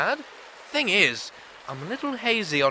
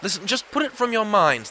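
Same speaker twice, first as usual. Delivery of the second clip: ordinary talk, nothing marked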